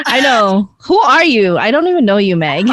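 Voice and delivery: loud, playful mock astonishment; mock disbelief. Host teasing introverted friend for going out twice, mock disbelief. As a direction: pretend not to recognize your friend, punching it loudly.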